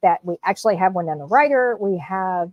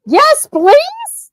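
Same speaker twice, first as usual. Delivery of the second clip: excited squeal; 8 dB louder than usual. Real, not acted. Eager repeat.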